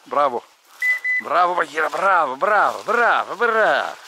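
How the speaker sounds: repeated loud bravos, rising; loud praise. Real, not acted. Hunter loudly cheering his dog for bringing the bird. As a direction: cheer the dog like it won a prize.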